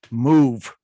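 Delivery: curt, loud, low bark of a word; a flat loud one-word order; blunt Dutch "Move". Retold or imitated. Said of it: "you know what the Dutch say".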